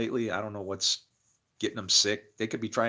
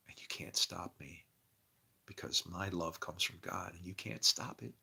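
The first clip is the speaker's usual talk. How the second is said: whisper; 6 semitones lower, 12 dB quieter than usual. Acted. Preacher intimately whispering love from God.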